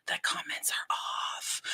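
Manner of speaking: hushed whisper, slow; slow stage whisper of disbelief. Pretend. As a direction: whisper it like a shocking secret. Whispered then shouted discovery.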